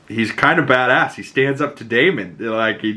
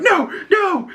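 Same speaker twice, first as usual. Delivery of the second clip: much higher, frantic cry of no; retold panicked shout. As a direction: beg for your life in a pitched-up scream. Man about to be beheaded.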